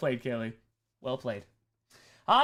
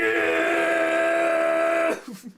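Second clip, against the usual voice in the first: loud cracked screech; screaming high note; 12 semitones higher, 5 dB louder than usual. Pretend. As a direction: belt until it breaks. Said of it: "Just wreck the vocal cords".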